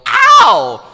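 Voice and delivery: very high, loud cracking yelp. Shown as a demonstration. Actor recreating a flicked Mia, cracking yelp. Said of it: "that voice crack was totally".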